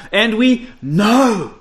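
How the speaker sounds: slow emphatic and we know; the certainty Paul means, said slowly; slow, weighty quote. Acted. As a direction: lean on each word slowly. Paul's words, set against feeling.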